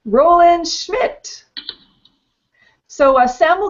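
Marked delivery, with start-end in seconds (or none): 0.1-1.4 winner announcement. Real, not acted.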